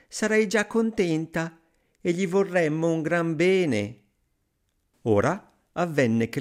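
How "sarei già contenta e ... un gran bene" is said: high, yearning woman's voice; long for it in a high voice; wistful sighing wife. A narrator's reading. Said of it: "rispose la donna sospirando". (moglie sospirosa, voce acuta)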